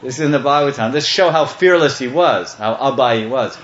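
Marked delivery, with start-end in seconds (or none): none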